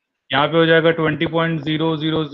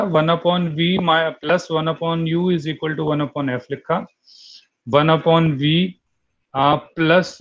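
slow dictation; much slower than usual. Shown as a demonstration. Dictating formula slowly to class.

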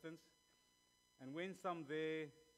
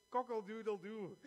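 loud, high cock-a-doodle-doo; rooster crow; 8 semitones higher, 8 dB louder than usual. Shown as a demonstration. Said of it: "That's part of my demonstration".

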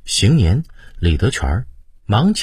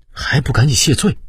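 urgent shout; 8 semitones higher than usual. A narrator's reading. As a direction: shout at him to apologize now. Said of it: "回头对胤禔喝道".

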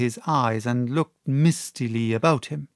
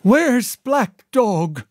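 higher, louder, very slow cry; 1 fevered cry; 9 semitones higher, 5 dB louder, much slower than usual. A narrator's reading. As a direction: call out in fevered dread.

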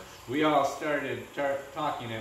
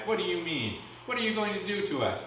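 higher, mildly plaintive questions; wailing; 6 semitones higher than usual. A narrator's reading. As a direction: plead with a touch of a wail.

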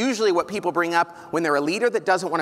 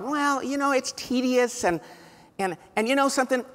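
whiny excuse; 7 semitones higher than usual. Retold or imitated. CEOs' excuses, mocked.

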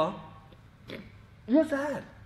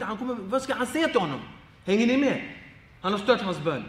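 ordinary talk

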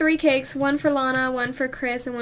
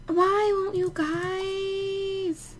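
high, very drawn-out whine; whiny, higher-pitched complaint; pleading whine; 6 semitones higher, much slower than usual. Real, not acted. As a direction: stretch every word into a plaintive moan. Whining at uncooperative game characters.

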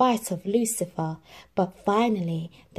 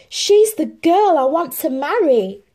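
higher, loud ardent declaration; lovestruck prince; 9 semitones higher, 10 dB louder than usual. Acted. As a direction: declare it with fervour. Prince declares his love.

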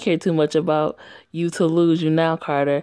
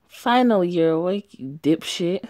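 ordinary delivery

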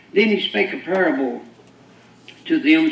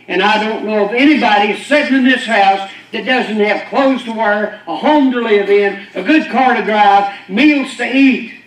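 builds louder line by line; rising preaching; 4 semitones higher, 7 dB louder than usual. Acted. Preacher lists blessings to the congregation, building louder. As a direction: swell it toward a shout.

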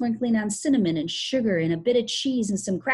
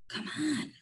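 soft, higher-pitched urgent whisper; 8 semitones higher, 7 dB quieter than usual. Acted. Joe urging Tom on, soft whisper. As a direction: urge a friend under your breath. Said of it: "whispered Joe to Tom".

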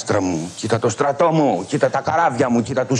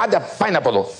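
raised, scornful shooing away; mock royal dismissal; 4 semitones higher, much faster than usual. Croesus shoos Solon away, raised scornful dismissal. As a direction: send him off like a pest, a touch higher.

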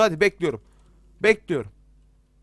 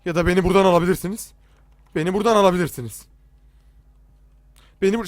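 fast begging; 5 dB louder, faster than usual. Real, not acted. Begging the game to let him out.

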